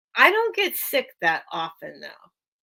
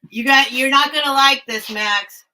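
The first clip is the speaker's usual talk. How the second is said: teasing warning; 3 semitones higher, 7 dB louder than usual. Real, not acted.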